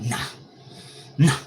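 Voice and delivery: short, punchy mimicked nah; two abrupt nahs, imitating a friend; imitated nah. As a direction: snap out nah, then repeat it. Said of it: "You say it just like that two times".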